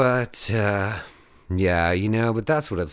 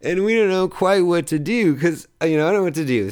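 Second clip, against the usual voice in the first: laughing; 9 semitones higher, 5 dB louder than usual. Real, not acted. Laughing while retelling confusion.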